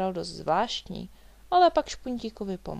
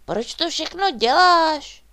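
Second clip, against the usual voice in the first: loud, high, drawn-out amazement; slow, high-pitched surprised question; wondering; 9 semitones higher, 12 dB louder, slower than usual. A narrator's reading. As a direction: ask it in puzzled wonder, pitched right up. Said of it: "divil se Špuntík".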